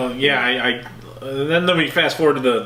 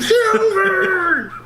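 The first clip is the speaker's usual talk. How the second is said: high, drawn-out call from the tunnel; cry from the dark. Pretend. Host heckling from the tunnel, high drawn-out cry. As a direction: call it out high, stretching the word. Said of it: "from the dark recesses of the tunnel".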